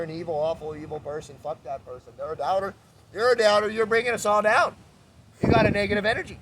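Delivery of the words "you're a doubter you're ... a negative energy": mocking judge. Mocking a judgmental person.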